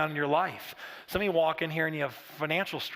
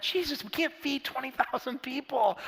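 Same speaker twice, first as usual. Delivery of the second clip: higher, slow, incredulous disciples; doubting disciples; 9 semitones higher than usual. Retold or imitated. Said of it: "And they're like".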